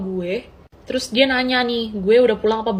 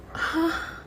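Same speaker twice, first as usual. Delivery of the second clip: stunned hah; 5 semitones higher, 10 dB quieter than usual. Retold or imitated. Shocked reaction to apology.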